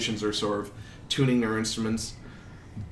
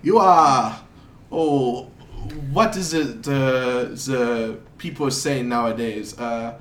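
put-on halting European accent, groping for the idiom; slow foreign accent, searching for words; wandering foreign accent; slower than usual. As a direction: play a muddled foreigner attempting slang. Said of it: "sounds like he's trying to say".

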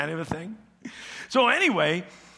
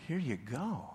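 soft repeat; 2 semitones lower, 8 dB quieter than usual. Retold or imitated. Esau repeats, softer.

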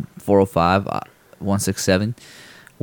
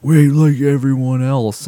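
retold yell; 6 semitones higher, 4 dB louder, slower than usual.

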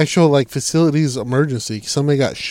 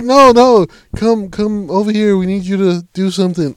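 higher, louder pleading; mock urgent coworkers; 6 semitones higher, 4 dB louder than usual. Coworkers begging him to come to the fire, mock urgent pleading. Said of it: "They're like".

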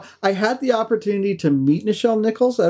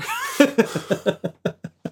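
breathy laugh; 5 semitones higher than usual. Real, not acted. Laugh after the punchline.

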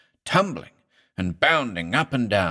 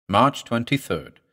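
ordinary delivery

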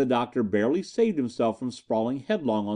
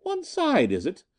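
very high, scornful Irish woman's retort; mocking Irishwoman; 9 semitones higher than usual. A narrator's reading. Amazon mocking Bolton's order, scornful high retort. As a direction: throw his words back at him mockingly. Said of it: "demanded the fiery-haired Amazon".

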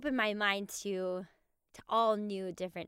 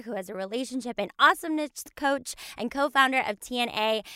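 loud, bright host welcome, much louder and higher; energetic podcast opener, fast and loud; podcast intro; 4 semitones higher, 9 dB louder, faster than usual. Acted. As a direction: greet the listeners like a hyped show host. Opens the podcast episode.

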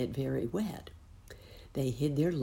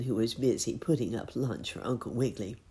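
usual speaking voice

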